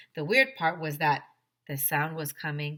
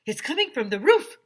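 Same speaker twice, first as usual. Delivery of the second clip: shouted; 7 semitones higher, 9 dB louder than usual. A narrator's reading. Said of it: "he shouted".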